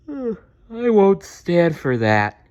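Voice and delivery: slow, sleepy, drawn-out protest; half-asleep but stubborn; sleepily. Acted. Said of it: "said Dazzle sleepily".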